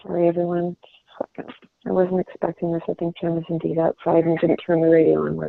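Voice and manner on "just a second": hushed whisper away from the call; whispered aside. Real, not acted. Whispering to someone nearby mid-call, an off-mic aside. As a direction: drop to a whisper for someone in the room.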